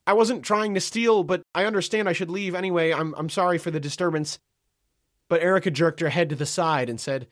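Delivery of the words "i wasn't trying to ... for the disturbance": high, hurried-to-explain apology, easing down; pleading. A narrator's reading. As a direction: plead your case, starting high and settling. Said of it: "pivoted back to Erica to plead".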